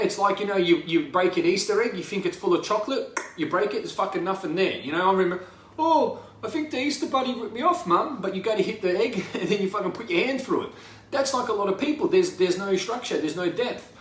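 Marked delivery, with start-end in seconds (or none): 5.8-8.2 put-on higher, childlike complaint to mum; child's voice. Kid telling mum the Easter bunny cheated him, whiny child voice. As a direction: sound like a disappointed little kid.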